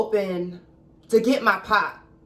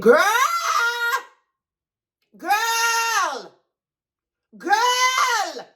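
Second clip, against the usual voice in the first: shocked exclamation; 14 semitones higher, much slower than usual. Real, not acted. Reacting to the filthy room.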